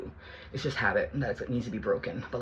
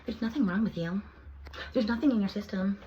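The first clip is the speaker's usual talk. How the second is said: higher, mocking imitation of the doctors; 8 semitones higher than usual. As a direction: parrot the doctors' dismissive line. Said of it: "come back and say".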